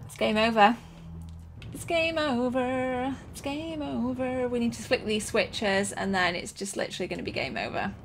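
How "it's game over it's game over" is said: slow, stretched wail of defeat; slow, trailing, resigned sing-song; drawn-out lament. Real, not acted. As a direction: draw out GAME and OVER mournfully.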